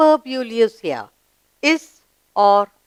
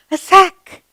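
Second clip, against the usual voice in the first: pupil answer; 9 semitones higher, 6 dB louder than usual. Acted. Answering own question, high voice.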